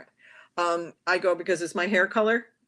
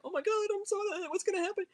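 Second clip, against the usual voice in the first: high, anxious, fluttery mock panic; mock anxious fretting; faster than usual. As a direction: play up the worried client in herself, way up high. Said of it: "if I'm an emotional about an issue".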